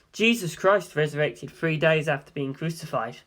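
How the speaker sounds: usual speaking voice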